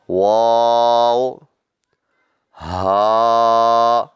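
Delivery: long, loud held letter names; letter drill. Shown as a demonstration. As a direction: chant each letter for the class. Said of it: "الف".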